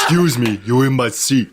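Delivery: botched action-star impression; unconvincing movie-star imitation; Vin Diesel. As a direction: try a low tough-guy celebrity voice. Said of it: "That's still not Vin Diesel".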